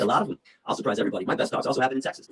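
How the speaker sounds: ordinary delivery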